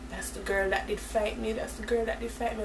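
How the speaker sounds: hushed, voiced stage whisper; whispering to mother. Daughter pointing out the girl to her mother, whispering. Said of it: "I whisper to mommy".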